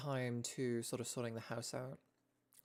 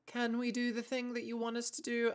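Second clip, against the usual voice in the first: examiner role; 10 semitones higher than usual. Pretend. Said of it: "gonna be looking me over going like".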